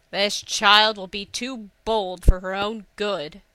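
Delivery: put-on slow, loud grumbling old soothsayer; fortune teller. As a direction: grumble it out like a cranky old seer. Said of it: "the fortune-teller grumbled".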